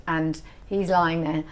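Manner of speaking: usual speaking voice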